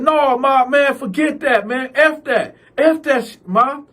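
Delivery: high heated cussing; angry. Retold or imitated. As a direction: snap back at mom high. Cal cussing at mother.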